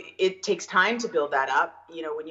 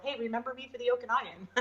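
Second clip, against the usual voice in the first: high, quick request breaking into laughter; joking plea; 8 semitones higher, 5 dB quieter, faster than usual. Retold or imitated. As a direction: toss it out as a laughing reminder. Joking quote of her request to agents.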